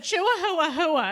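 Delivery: "Chihuahua hua" stretched slowly, high and loud with a laugh; mocking "Chihuahua hua" with a giggle; mock mispronunciation. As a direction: drawl out "Chihuahua hua" like a proud mistake. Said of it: "she just said".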